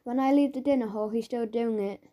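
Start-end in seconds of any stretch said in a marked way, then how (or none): none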